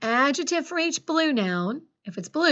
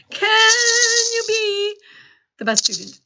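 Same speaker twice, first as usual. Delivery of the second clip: rousing challenge; 11 semitones higher, 7 dB louder than usual. Real, not acted. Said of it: "Can you take on the challenge?".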